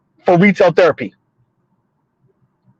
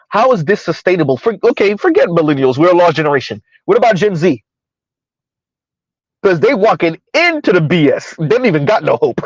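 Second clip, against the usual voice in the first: normal talking voice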